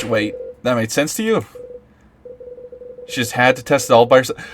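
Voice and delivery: normal talking voice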